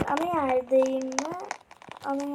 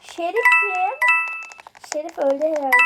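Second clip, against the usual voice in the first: excited; 4 semitones higher, 8 dB louder than usual. Real, not acted. Excited game moment.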